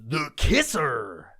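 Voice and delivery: slow, drawn-out DJ station call; milk the name like a cheesy DJ; radio DJ voice. Retold or imitated. Mimicking the KISR DJ, slow drawn-out station call. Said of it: "why do they do that voice?".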